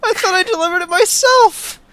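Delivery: whiny nervous informant. Acted. Herbert protests, higher pitch.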